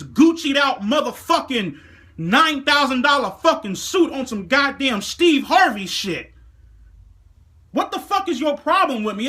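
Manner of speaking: heated yelling at the manager who judged him; furious rant. Acted. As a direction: tear into her like you've had enough. Ranting at the snobby manager.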